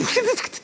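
a sudden squeaky laugh; high burst of laughter. Real, not acted. Laughing at the dog story.

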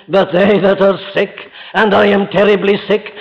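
normal talking voice

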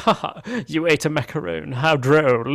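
mock upper-class amusement; condescending. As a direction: laugh down at them, imitating a snob. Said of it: "like, condescending way".

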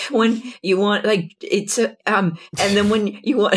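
put-on high, stumbling little boy. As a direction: mimic a kid who can't finish a sentence. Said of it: "He's like".

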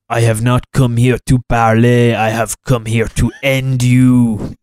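imitating a bad movie French accent, slowed; mock French accent. Mocking the Dauphin's French accent in the film. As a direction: ham up an over-the-top French villain accent. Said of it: "Some of his quotes are just absolutely atrocious".

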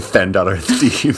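slow lisped Spanish s sounds, laughing. Retold or imitated. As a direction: slowly lisp it, laughing. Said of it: "the Spanish lisp".

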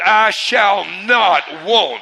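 very slow and loud, each word weighted, "not" punched; "not" stretched out and stressed forcefully; strong emphasis. Shown as a demonstration. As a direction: declare it word by word, hammering "not". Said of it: "Put some emphasis on it".

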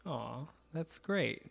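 a slow, soft, mock-sentimental "aw"; mock touched. Real, not acted. Cohost teasing a friend's faint praise with mock tenderness. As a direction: pretend to be moved, softly and slowly. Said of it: "it's like you're so touched".

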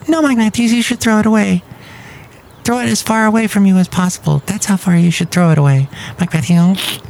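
high, put-on puppet voice; a silly high character scolding Mike; squeaky puppet voice.

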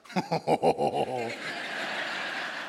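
short mocking laugh at a half-naked guy; imitated laugh. Student passing by laughs at him in a towel. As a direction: imitate the student's amused chuckle. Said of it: "just went".

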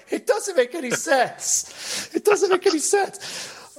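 high, slow disbelief; exasperated. Real, not acted. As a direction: plead it in disbelief. Exasperated repeated complaint.

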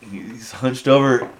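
ordinary delivery

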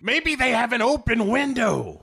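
high put-on detective voice; voiced detective. Detective snooping around back, high mock voice. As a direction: pitch it up like a dopey cop.

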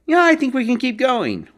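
high, chirpy mock cheerfulness; mock overeager rider. Mocking an upbeat rider who wants to push through gusting winds, high and chirpy. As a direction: lampoon the rider who ignores the wind forecast. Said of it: "someone in the ride who's like".

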